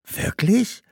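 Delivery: put-on high, wondering girl's question; lightly voiced surprise, pitch leaping up; surprised question. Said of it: "fragte Fatima".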